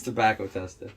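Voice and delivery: "tester" low and loose, final r dropped like "testa"; off-the-hip pronunciation. Shown as a demonstration. Showing how he says it to somebody, casual "testa" for "tester". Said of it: "when I just off the hip".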